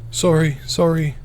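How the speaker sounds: high, slow, near-sobbing apology; tearful mumbling mother. Acted. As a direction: mumble it on the edge of tears. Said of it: "Her mumblings then turn into sobbing".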